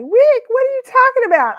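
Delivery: high, loud incredulous niece; mock a clueless girl's disbelief; imitating the niece. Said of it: "She's like".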